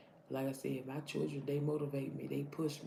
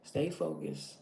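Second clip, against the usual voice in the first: voice of God; slower than usual. Retold or imitated. God telling her in her struggle to stay focused, lightly voiced. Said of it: "And he told me".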